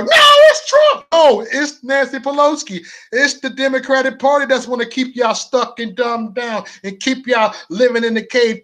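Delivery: high, loud sarcastic parody of critics; mocking opponents. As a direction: squeal out their excuses in mockery.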